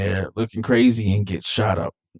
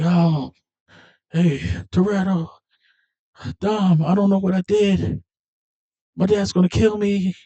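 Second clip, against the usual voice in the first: put-on panicked kid; 10 semitones higher than usual. Jesse panicking to Dom.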